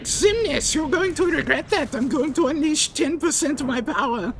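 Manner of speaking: very high squeaky villain voice; high-pitched mock villain threat; Xemnas impression.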